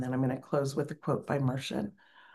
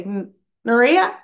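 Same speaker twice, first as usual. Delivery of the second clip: strong call; 6 semitones higher, 10 dB louder than usual. Retold or imitated. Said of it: "she had a strong voice".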